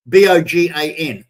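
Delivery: B O G A N, each letter said separately; spelling it out. Shown as a demonstration. Spelling bogan out for American viewers, letter by letter. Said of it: "we call them bogans".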